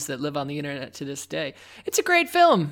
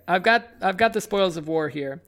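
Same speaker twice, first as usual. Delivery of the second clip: normal talking voice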